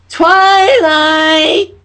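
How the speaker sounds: high, loud excited shout of a name; excited call. Acted. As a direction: burst in calling her friend. Pinkie Pie bursts in calling.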